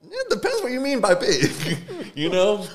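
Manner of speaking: laughing at his own joke. Real, not acted. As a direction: crack up while delivering the joke. Punchline joke to the mother.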